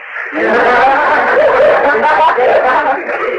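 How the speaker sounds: rising amused laugh; real laugh. Real, not acted. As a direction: laugh at the silly name. Laughing at Charlie Spider name.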